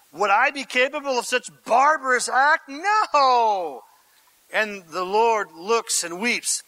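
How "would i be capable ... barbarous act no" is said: raised, higher-pitched protest of innocence; mock-affronted denial; indignant denial. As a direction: act out offended disbelief, ending on an emphatic no. Acted denial of the accusation.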